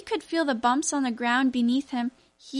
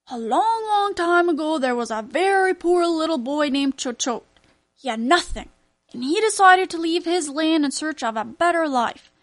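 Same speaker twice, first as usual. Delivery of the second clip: louder, grand fairy-tale telling; storytelling grandfather; 4 semitones higher, 5 dB louder than usual. A narrator's reading. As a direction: spin the tale with drama.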